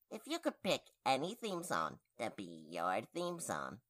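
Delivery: ordinary talk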